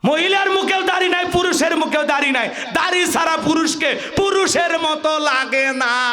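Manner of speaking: full-force preaching. Real, not acted. Preacher tells men they need beards, full-force preaching. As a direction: belt it out and stretch the ending.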